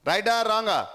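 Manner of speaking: ordinary talk, nothing marked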